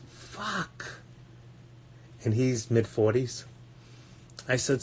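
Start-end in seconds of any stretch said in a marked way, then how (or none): 0.1-1.0 much higher, much softer, barely voiced; stunned curse. Hearing the guy's Death Clock age, a stunned soft curse. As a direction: let the curse slip out, floored. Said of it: "I says".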